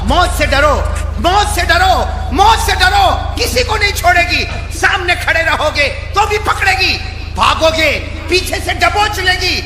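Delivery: loud, high fire-and-brimstone sermon; shouted preacher's exhortation; full-force preaching. Acted. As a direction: thunder the warning at the whole crowd.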